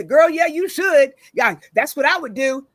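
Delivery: loud, high mock hype; egging on. Friend wrongly cheering on an angry caller, egging on.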